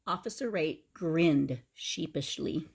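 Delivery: usual speaking voice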